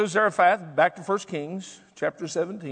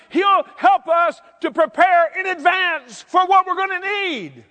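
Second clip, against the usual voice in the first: high, loud preacher's shout; impassioned pulpit cry; full-force preaching; 10 semitones higher, 6 dB louder than usual. Real, not acted. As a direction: drive the point home to the congregation.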